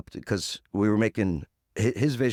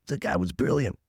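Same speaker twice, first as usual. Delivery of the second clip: normal talking voice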